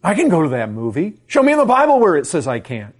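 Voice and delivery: loud, fast, high-pitched mock defiance; defiant legalist. Preacher acting out a defiant moviegoer, loud challenge. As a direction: argue back loudly, daring to be contradicted.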